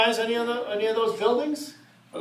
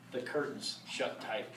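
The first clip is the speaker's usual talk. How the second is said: hushed for effect; 7 semitones lower, 13 dB quieter, slower than usual. Shown as a demonstration. Reader hushing the line to spook the audience, softer. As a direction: drop to a hush on the last words. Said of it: "That was for effect".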